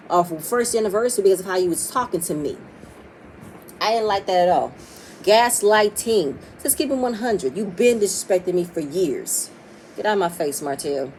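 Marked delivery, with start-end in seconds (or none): none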